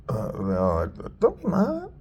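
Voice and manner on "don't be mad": high, sweet coaxing voice; comforting voice. Retold or imitated. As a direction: coo it like soothing an upset pet. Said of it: "he actually looked after the other one".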